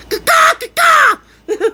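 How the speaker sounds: long, high, shouted crow caw; drawn-out squawking bird call at a door; crow wake-up call. Retold or imitated. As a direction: caw like a rooster to wake the neighbor. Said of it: "wake his ass up".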